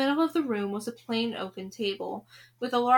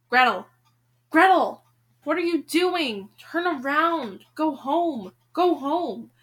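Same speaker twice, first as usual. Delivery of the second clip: higher, louder plea to turn back; alarmed, raised storyteller's warning; urgent calling out; 5 semitones higher, 5 dB louder, slower than usual. Acted. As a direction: call out to warn her away. Narrator urgently calls out to Gretel.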